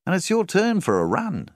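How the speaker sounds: butter him up with a high, honeyed tone; very nicely. A narrator's reading. Banker coaxing a struggling farmer, high and sugary. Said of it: "He said it all very nicely".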